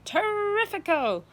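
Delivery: high, loud, slow delighted cry; excited cry. A narrator's reading. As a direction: savour the word in glee. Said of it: "Aunt Sponge cried out".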